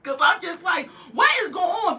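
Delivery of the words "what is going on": high, exasperated what is going on; baffled. Retold or imitated. Vlogger baffled by trolls in her live, high exasperation. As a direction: ask it like you can't believe it.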